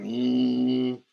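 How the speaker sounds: long steady mock buzz; imitated vibrator hum. Streamer imitating a vibrator to listeners, droning hum. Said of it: "Vibrator was like".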